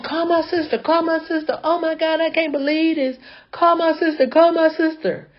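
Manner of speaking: high, frantic repeated begging; panicked pleading. Retold or imitated. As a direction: beg in a rising panic. Panicked driver begging for help.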